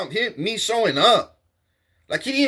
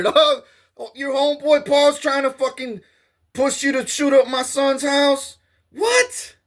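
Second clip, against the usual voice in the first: retold rant climbing higher; heated phone rant; 6 semitones higher than usual. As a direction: yell it down the phone, building up.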